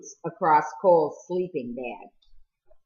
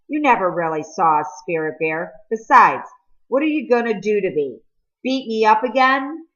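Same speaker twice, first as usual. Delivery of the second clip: taunting, rising louder to a jeer; mocking taunt; 5 semitones higher, 5 dB louder than usual. Boy taunts Cole about beating him, jeering. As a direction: goad him, daring him to hit.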